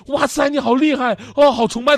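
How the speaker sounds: high, gushing mock awe; put-on starstruck flattery; feigned admiration. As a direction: gush with fake worship of a boaster. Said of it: "咱们脸上还得装出".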